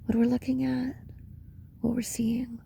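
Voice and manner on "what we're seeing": normal talking voice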